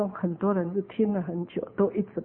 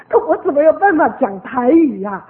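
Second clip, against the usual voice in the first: louder, higher, amused exasperation; laughing protest; 4 semitones higher, 9 dB louder than usual. Real, not acted. Speaker joking to listeners about speaking Taiwanese, laughing protest. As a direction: protest loudly through a laugh.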